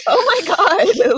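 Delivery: high, loud gasp of alarm; mock shock. Reacting to alarming texts, high loud mock shock. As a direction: react like the sky fell. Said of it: "And I was like".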